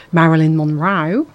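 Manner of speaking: an Australian twang slipping into the name; Australian accent. Real, not acted. Radio host slips into an accidental Australian accent.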